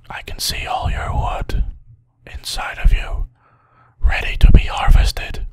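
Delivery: whispered low and eerie to the trees; unsettling whispered secret; creepy whisper. Pretend. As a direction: breathe it like a creep.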